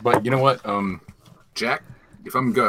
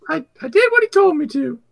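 quick, very high cartoon squeak; hurried squeaky gator falsetto; Gus the Gummy voice; faster than usual. Gus reporting he obeyed, quick squeaky voice. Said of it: "Gus did very well".

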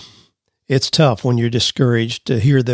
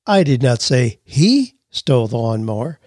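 "he" raised and set apart by pauses; stress on HE; 3 semitones lower than usual. Shown as a demonstration. Host shows emphasis changes meaning, stress on "he". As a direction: lean on "he" as the word in question. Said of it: "change the meaning dramatically just by your emphasis".

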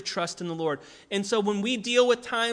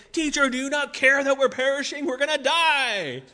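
panicked disciples; 5 semitones higher, 4 dB louder than usual. Retold or imitated.

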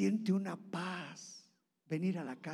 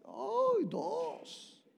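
delighted squeal. Pretend. Man delighted by temptation.

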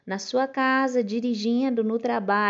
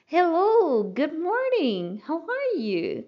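cheerful greeting; 7 semitones higher, slower than usual. Shown as a demonstration. Teacher models a cheerful morning greeting. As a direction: greet brightly, starting high. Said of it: "Vai ficar mais ou menos assim".

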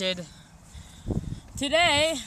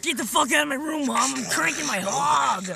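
put-on loud high teen yell; yelling at mom; 6 semitones higher, 9 dB louder than usual. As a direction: scream it at your mom.

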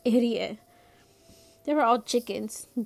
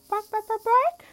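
high-pitched mocking bark-bark, last one louder; whispered chicken clucking; 11 semitones higher, 5 dB louder than usual. A narrator's reading. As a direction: tease with squeaky chicken squawks. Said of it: "my sister whispered".

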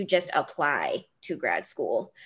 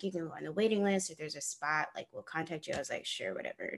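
dismissive aside; 2 semitones lower, 10 dB quieter, faster than usual. Retold or imitated. Applicant brushing off the waiting list offer, dismissive aside. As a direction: toss it off quietly.

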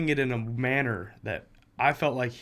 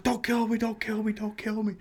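imitating a panicked plea, much higher; mock panic; 10 semitones higher than usual. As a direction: squeak it out like a terrified friend. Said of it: "He starts freaking out".

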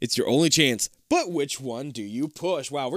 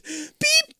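squeaky imitated beep; high beep tone. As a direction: chirp the tone. Phone tone again.

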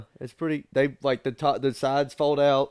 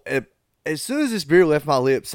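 ordinary talk

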